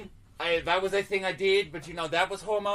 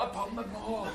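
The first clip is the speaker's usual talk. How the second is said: much softer, fading repeat; hushed echo; 9 dB quieter than usual. Acted. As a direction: let it trail like a distant echo. Echoes own last words for effect.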